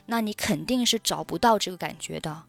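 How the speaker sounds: usual speaking voice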